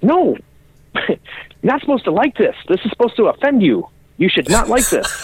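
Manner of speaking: high, squeaky mock outrage; a hammed-up no you're not supposed to like this; mock indignant protest. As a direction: protest in a high put-on voice that they liked it.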